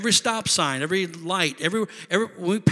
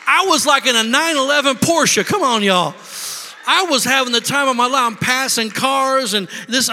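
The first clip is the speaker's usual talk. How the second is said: loud and high; preacher reliving the drive, jubilant; excited; 7 semitones higher, 5 dB louder than usual. Real, not acted. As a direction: shout the joy out. Excited climax.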